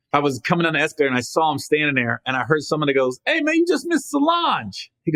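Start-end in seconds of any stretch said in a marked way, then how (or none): 3.2-4.9 high, drawn-out imitated call. Bystander calls to airport reporter about a missed celebrity, high mock call. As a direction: call it out in a much higher mock voice.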